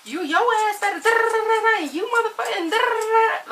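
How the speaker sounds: high, loud, ranting parent going off; mock parent yelling. Parent going off at their kid, loud high mock rant. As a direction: imitate a fed-up parent cussing out a kid. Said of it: "we be yelling ... at them".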